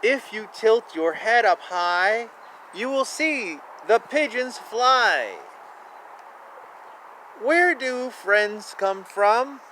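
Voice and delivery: gentle sing-song picture book read; lilting storybook narration; reading aloud. Acted. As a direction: read it like a bedtime rhyme for kids.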